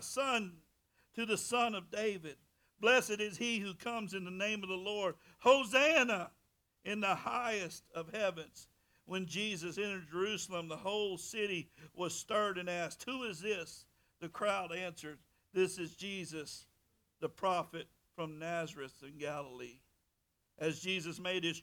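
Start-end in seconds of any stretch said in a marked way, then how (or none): 0.0-5.2 steady reading of the Gospel; scripture reading. Acted. 5.4-6.3 loud, high Hosanna; crowd's shout. A narrator's reading. 6.8-19.8 steady reading of the Gospel; scripture reading. Acted.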